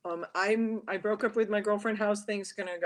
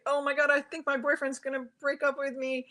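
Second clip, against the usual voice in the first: higher, fretful imitation of herself upset; mock upset worry; 8 semitones higher than usual. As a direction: act out a worried girlfriend, half-serious. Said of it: "when I'm all upset".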